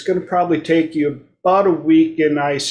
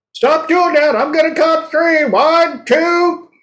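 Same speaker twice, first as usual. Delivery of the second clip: much higher, louder exasperated parent counting; mock stern parent; 12 semitones higher, 4 dB louder, slower than usual. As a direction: play the fed-up parent warning a kid, pitched way up. Parent counting to three at kid.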